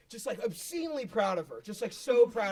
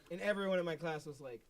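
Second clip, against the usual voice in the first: ordinary talk, nothing marked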